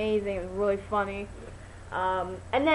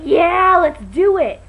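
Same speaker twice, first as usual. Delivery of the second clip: loud, high whoop of agreement; excited cheer; 8 semitones higher, 12 dB louder, much slower than usual. Retold or imitated. Telling viewers how she agreed to the prank, excited shout. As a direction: shout it like you're all in.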